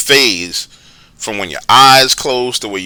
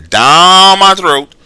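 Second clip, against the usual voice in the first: very slow, louder and higher on a long DOWN; stretched-out retelling; 8 semitones higher, 6 dB louder, much slower than usual. Retold or imitated. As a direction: stretch DOWN all along the slide. Acting out finger sliding down.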